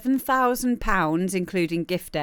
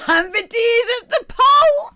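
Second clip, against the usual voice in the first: laughing; 9 dB louder than usual. Real, not acted. Laughing at a viewer's joke.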